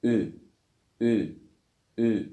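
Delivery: "Ü" as ee through rounded lips; isolated "Ü", repeated with pauses between; Turkish ü demo. Shown as a demonstration. Teacher reviews the sixth letter, isolated rounded ü. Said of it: "الحرف السادس".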